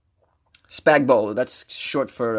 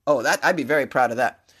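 ordinary delivery

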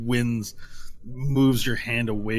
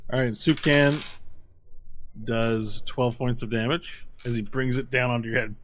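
ordinary delivery

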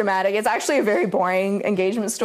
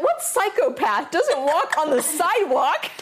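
higher, slow, laughing disbelief; incredulous; 5 semitones higher, slower than usual. Retold or imitated. Girlfriend retelling being dragged onto grass, incredulous. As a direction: demand it in exaggerated, amused disbelief. Retold outburst at boyfriend leaving path.

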